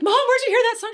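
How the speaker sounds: high, loud retold alarm; alarmed question. Daughter asks mom about the ghostly song, acted alarm. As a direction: re-enact a freaked-out kid questioning.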